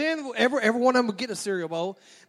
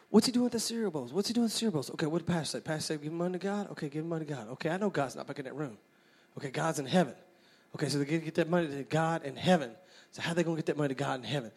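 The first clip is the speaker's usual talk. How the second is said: hurried, softer thinking aloud; racing thoughts; 4 semitones lower, 7 dB quieter, faster than usual. Retold or imitated. Young boy working out where the money goes, hurried muttered reasoning. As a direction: puzzle it out quickly, lightly under the voice. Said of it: "my little mind's going nine to nothing".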